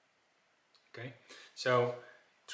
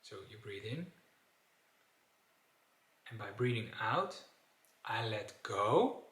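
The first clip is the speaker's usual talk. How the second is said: calm and soft; 4 dB quieter than usual. Shown as a demonstration. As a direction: ease into the out-breath. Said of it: "how does it feel inside".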